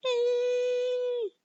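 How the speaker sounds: long, loud falling scream; high shriek. Acted. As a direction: scream as you drop. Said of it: "she shouted".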